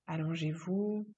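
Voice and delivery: ordinary delivery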